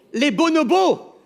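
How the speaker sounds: high, loud, slow reveal; big reveal. Pretend. A comedian unveils the answer to the audience, high slow reveal. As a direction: unveil the answer like a game show host.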